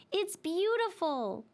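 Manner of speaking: high, slow, wondering little voice; awed dreidel. Acted. Dreidel admires the children's house, slowly in awe. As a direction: marvel at the house.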